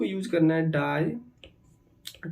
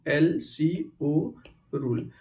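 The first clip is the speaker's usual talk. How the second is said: "LCO rule" very slow, each letter drawn out; slow dictation; 2 semitones lower, much slower than usual. Shown as a demonstration. Teacher repeats the rule name for students, slowly letter by letter. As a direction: spell out "LCO" letter by letter, unhurried.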